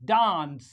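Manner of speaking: DANCE as 'dahns', long open vowel; British long A. Shown as a demonstration. Teacher modelling British English, long-ah DANCE. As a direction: say dance with the A of father. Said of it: "The same way we pronounce".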